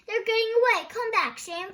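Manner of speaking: high, loud desperate calling. Acted. Thorndyke calling after his escaping spiders, loud and high. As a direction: call the pets back.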